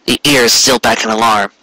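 usual speaking voice

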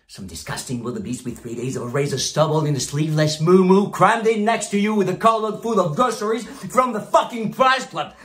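mounting, punched-out scorn; disgusted tirade. Acted. Boss paints brokers' miserable future, disgusted tirade. Mocking staff's imagined poor future.

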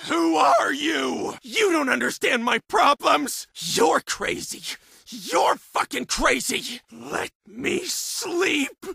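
agitated patient lashing out at voices; frantic, erratic outburst; tormented, deranged shouting. Acted. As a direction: shout as if cornered and losing your mind.

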